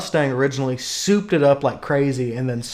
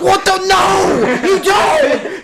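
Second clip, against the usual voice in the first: high, loud incredulous yell; re-enacted shriek of disbelief at a brother; exasperated outburst; 5 dB louder, much slower than usual. Retelling the row with brother over a new Jeep, exasperated shouting.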